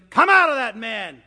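loud exorcising order; forceful command. Acted. Jesus commanding the evil spirit.

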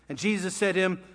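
ordinary talk

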